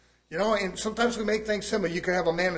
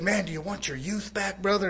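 Brother Pike's voice; 3 semitones higher than usual. Retold or imitated. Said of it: "speaking like this".

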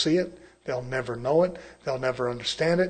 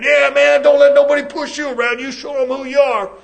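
loud, high cheering-on voice; rowdy, shouted encouragement; fleshly man egging on; 9 semitones higher, 10 dB louder than usual. Acted. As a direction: mimic a man urging his boy to stand tall.

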